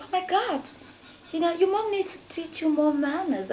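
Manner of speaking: disapproving, lecturing about manners; scolding. Retold or imitated. Mother telling rude kids their mom should teach manners, scolding. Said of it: "I was like".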